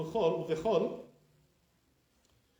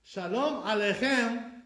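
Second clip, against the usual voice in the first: Shalom Aleichem slow and higher, a-lei-CHEM; correct final stress; 5 semitones higher, 5 dB louder, slower than usual. Shown as a demonstration. Teacher modelling right way, slow emphatic final-stressed Aleichem. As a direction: spell out Aleichem slowly to a strong final syllable. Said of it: "Wrong way".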